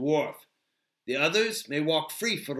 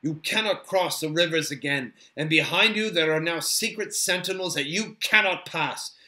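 firm, lightly raised warning; understated elven sternness; stern Haldir; 4 semitones higher, 7 dB louder than usual. A narrator's reading. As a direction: lay down the law with authority. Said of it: "said Haldir sternly".

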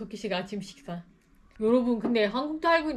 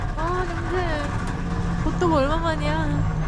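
excited; 8 semitones higher, 7 dB louder, much slower than usual. Real, not acted. Eating hot hotteok.

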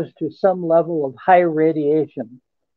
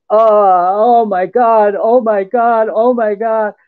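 retold gasping awe; 4 semitones higher, 4 dB louder than usual. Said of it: "gasping in awe".

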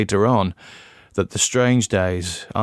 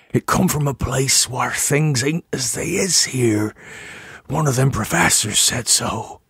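lightly voiced old farmer, a touch higher; a hint of a dying rustic man quoting; rustic farmer's words; 4 semitones higher than usual. Narrator voices dying Nahum's words.

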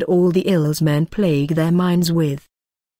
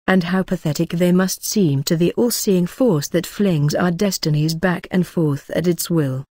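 ordinary delivery

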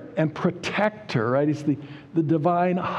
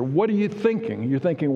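normal talking voice

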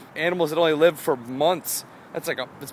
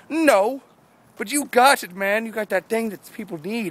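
high, hyped-up admirer; acted insistent praise; 8 semitones higher, 4 dB louder than usual. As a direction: insist with exaggerated enthusiasm.

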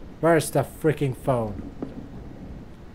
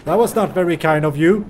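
ordinary talk, nothing marked

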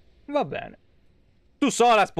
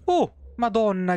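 startled; 7 semitones higher than usual. Real, not acted. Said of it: "che infarto".

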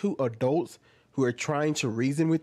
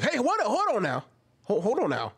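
taken aback; 7 semitones higher than usual. Acted. Reacting in disbelief to escalation.

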